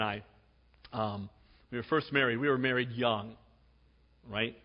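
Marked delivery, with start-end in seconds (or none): none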